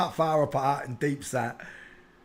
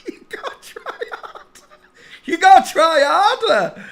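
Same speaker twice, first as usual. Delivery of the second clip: high, laughing through the words; laughing taunt; 11 semitones higher, 5 dB louder than usual. Real, not acted. Taunting the Brazilian troll, laughing out loud.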